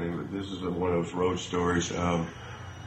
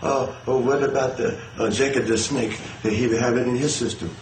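higher-pitched mock Sheik, innocently questioning; Iron Sheik; 5 semitones higher than usual. Sheik asking Vince about another wrestler, higher mock voice. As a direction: imitate the Sheik angling to share the blame. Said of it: "She's like".

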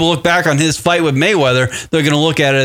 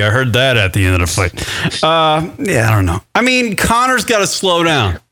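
ordinary talk, nothing marked

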